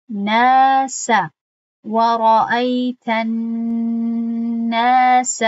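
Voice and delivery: ناس and و رأیت الناس recited slowly and loud, long a held two counts; recited example. Shown as a demonstration. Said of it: "در اینجا نظر کنید".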